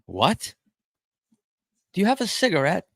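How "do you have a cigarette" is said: put-on British accent.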